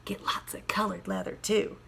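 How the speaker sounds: hushed advice. Acted. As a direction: whisper it to her husband. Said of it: "advised his wife".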